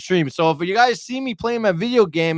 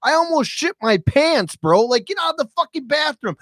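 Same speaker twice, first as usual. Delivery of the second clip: high, insistent retold plea; exasperated pleading; 10 semitones higher than usual. Dad explaining the emergency to crying daughter, high exasperated plea.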